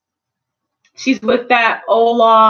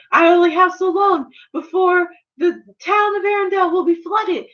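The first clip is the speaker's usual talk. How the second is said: mock urgent shout; 6 semitones higher than usual. Elsa urgent.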